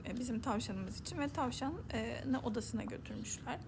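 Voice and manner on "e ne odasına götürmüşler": ordinary delivery, nothing marked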